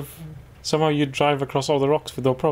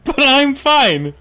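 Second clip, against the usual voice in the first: ironic shout; 9 semitones higher, 6 dB louder, much slower than usual. Real, not acted. After car explodes, mock reassurance.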